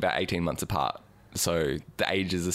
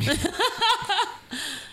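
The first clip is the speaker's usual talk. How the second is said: high laugh. Real, not acted. Cohost laughing at the cat joke, high squeal. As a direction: burst of high-pitched laughter.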